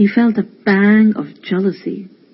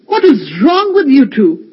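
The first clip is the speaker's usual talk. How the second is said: higher, louder, indignant cry; angry outburst; 6 semitones higher, 5 dB louder than usual. Acted. Older brother scolding the boys over the messy room, angry. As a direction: let the frustration burst out.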